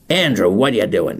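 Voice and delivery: attempted London accent, lightly put on. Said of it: "he'd put in a London accent".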